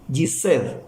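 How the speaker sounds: Gised said as the English "Jesus", "jee-zus"; English pronunciation. Shown as a demonstration. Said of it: "pero en inglés".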